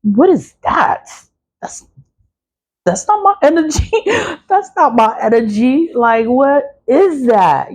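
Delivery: high, startled squeal of disbelief; half-laughing freaked-out what-is-that; shocked disbelief. Retold or imitated. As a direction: recoil as if hit by a strange energy. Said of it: "You're like".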